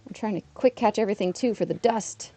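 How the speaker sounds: usual speaking voice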